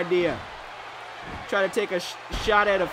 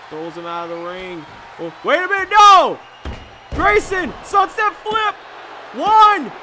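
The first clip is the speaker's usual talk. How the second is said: high, loud live play-by-play; wrestling commentator; 5 semitones higher, 5 dB louder than usual. Real, not acted. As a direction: call the match like ringside.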